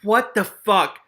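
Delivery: high, loud outburst; shocked disbelief. Real, not acted. Reader reacting to the rat king reveal, shocked. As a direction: exclaim in real shock.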